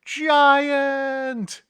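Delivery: high, loud frightened shout; little man shrieking up at the giant, loud and high; scared yell. Acted. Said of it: "where the man is yelling".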